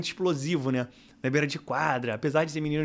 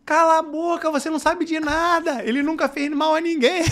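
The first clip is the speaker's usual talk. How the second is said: much higher and louder with a laugh in it; parents yelling back; 12 semitones higher, 5 dB louder than usual. Retold or imitated. Home parents shouting down the rival fans, loud and high. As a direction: fire back at the rival parents in defence of the coach. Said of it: "A minha torcida aqui, os pais".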